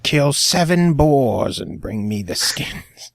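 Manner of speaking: slow, put-on quest-giver voice; hammed-up video game errand voice. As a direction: drag it out like a game character handing out a task.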